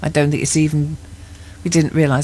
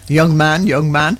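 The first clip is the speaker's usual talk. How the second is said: mock scolding; 5 dB louder than usual.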